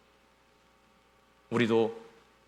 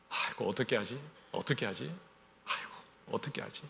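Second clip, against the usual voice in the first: much softer, anxious self-talk; fretful muttering; 2 semitones lower, 9 dB quieter than usual. Retold or imitated. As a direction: fret under your breath. Worried believer fretting over drink.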